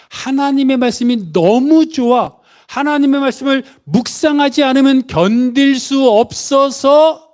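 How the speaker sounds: high, eager rush of delight; can't bear to go without it; acted delight. Preacher voicing a believer who loves the Word, delighted. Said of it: "나의 기쁨이란 뜻을 가진 것으로".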